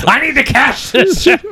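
high, laughing put-on customer voice; chuckling through a put-on voice at the store counter; mock Murdoch voice. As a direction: play a pushy billionaire cashing a giant check.

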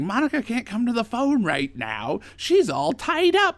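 a gleeful high ghoul savouring the punchline; imitating the Crypt Keeper's voicemail greeting. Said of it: "picture the voice".

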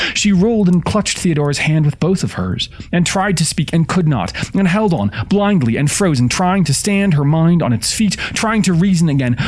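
high, urgent storytelling; panicked narration. A narrator's reading. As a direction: narrate breathlessly high, caught up in her terror. Narrator carried by Eleanor's panic.

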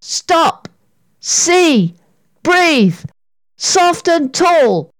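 high, deliberate step-by-step instruction; urgent coaching. A narrator's reading. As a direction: coach a panicking friend, one step at a time. Sarah coaches Katy through a drill.

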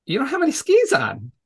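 mock surprise at a sudden discovery; imitating surprised student. Student pointing out the instructor's missing skis, surprised.

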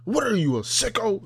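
high-pitched, affronted comeback; scandalized, high-voiced denial; mock indignation. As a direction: act appalled at the accusation, voice shooting up. Acts out accused man's indignant reply.

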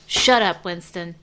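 sharp scolding. Real, not acted. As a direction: raise your voice to silence someone interrupting. Scolding Winston mid-sentence.

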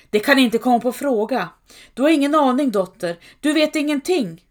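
fast, high and loud shouting; screaming again. A narrator's reading. Mother screams at her daughter that she knows nothing, high and loud. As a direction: scream it down in a rush. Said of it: "Mor skrek igen".

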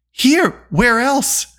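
high-pitched, irritated snap; snapped. A narrator's reading. Unseen Dolly answering where he is, snappish. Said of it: "snapped a voice".

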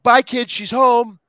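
eager greeting. Retold or imitated. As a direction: call out gladly as your friend arrives. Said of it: "You're like".

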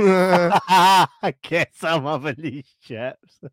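slow, high, laughing repeat; can't get it out for laughing; laughing through words. Real, not acted. Laughing while repeating the punchline.

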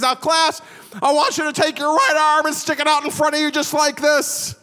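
mock squeaky, high-pitched little lecturer; high squeaky professor. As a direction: squeak it out like a scrawny, odd professor. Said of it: "he had this high squeaky voice".

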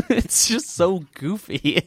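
slow, laughing through words. Real, not acted. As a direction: giggle it out. Laughing at goofy music.

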